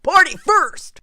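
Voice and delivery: high, loud, gleeful cheer; rally the room to party; excited party call. Acted. Pan cheering his friends on to party, loud and gleeful.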